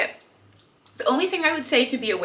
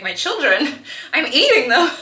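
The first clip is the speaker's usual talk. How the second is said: laughing; 4 semitones higher, 5 dB louder than usual. Retold or imitated. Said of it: "you're like".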